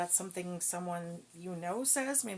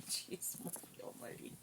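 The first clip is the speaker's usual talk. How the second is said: whisper; 12 dB quieter than usual. Real, not acted. Recovering from the smell.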